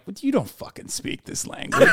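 whispered disbelief; incredulous whisper. Retold or imitated. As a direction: hiss it in disbelief. Incredulous aside about the YouTuber.

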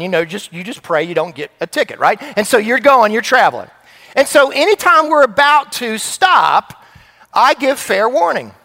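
ordinary delivery

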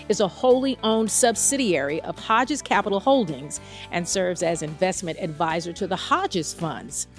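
normal talking voice

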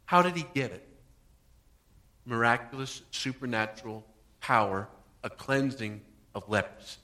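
ordinary talk, nothing marked

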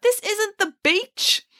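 high, slow, pointed remark; exasperated chiding. A narrator's reading. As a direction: spell out the obvious to a child. Hannah chides the barefoot girl.

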